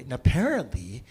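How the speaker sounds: ordinary talk, nothing marked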